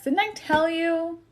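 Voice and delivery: high, slow knowing tease; told-you-so. Pretend. Reader teasing viewers as Spirit, smug and slow.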